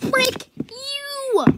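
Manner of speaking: high, loud frustrated yell; yelling at a carpet stain; angry yell. Real, not acted. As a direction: snap at the stubborn stain. Yelling at stubborn spot.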